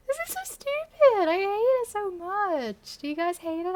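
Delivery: high, loud, pained cringe; cringing wail. Real, not acted. Reading her teen story aloud, wailing in embarrassment.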